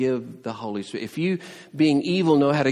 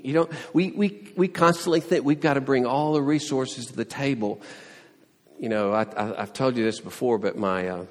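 ordinary delivery

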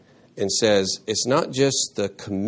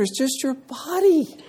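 high mocking voice of the other side; a sneering impression of the Corinthians; mock voice of opponents; 14 semitones higher than usual. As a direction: voice their careless view, mocking.

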